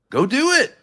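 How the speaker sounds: sharp, high, loud command; angry shout. Real, not acted. Arguing partner barks at her in anger. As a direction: snap it at them in anger.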